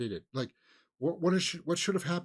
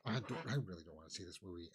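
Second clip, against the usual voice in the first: reluctant grumble; 6 semitones lower, 12 dB quieter, faster than usual. Retold or imitated. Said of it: "where I've been like".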